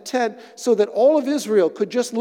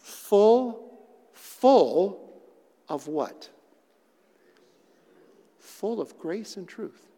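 hushed; 2 semitones lower, much slower than usual. Real, not acted. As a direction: drop to a hushed near whisper. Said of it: "look at this".

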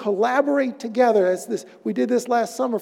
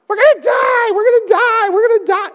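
high, loud, panicked yelling of disciples; panicked shout; 9 dB louder than usual. Retold or imitated. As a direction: scream it like you're drowning.

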